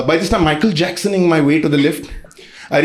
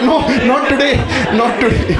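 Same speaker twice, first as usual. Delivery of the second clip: higher, urgent pleading to the dog; 6 semitones higher than usual. Retold or imitated. Begging the excited dog away on the way to the toilet. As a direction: beg the dog to stop. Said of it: "I'm like".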